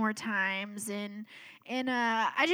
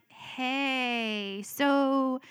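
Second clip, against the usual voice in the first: drawn-out, sheepish lead-in; reluctant, stalling greeting; 3 semitones higher than usual. Retold or imitated. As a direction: stretch it out, easing into bad news. Retold hesitant call to sister.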